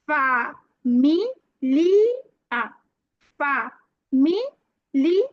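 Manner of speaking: "familia" drawn out as fa... mi... li... a; syllable by syllable. Shown as a demonstration. Teacher models a Spanish word for learners, syllable by syllable. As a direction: say "familia" very slowly, pausing between syllables.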